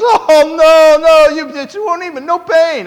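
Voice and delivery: high-pitched, loud mock Indian accent; imitated Indian doctor. Doctor promising patient no pain, high put-on Indian accent. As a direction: brush off the fear in an exaggerated Indian accent. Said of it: "she talked like this".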